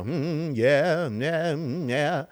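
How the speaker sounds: mock preacher cadence, sing-song humming; rhythmic preacher. Mocking a candidate's pulpit speech, in rhythmic preacher style. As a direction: hum and lilt like a pastor mid-sermon. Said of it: "iambic pentameter rhythmic speech that we".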